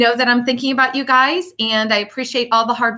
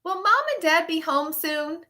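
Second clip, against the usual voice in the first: put-on child asking; 6 semitones higher, 6 dB quieter than usual. Child asks grandpa.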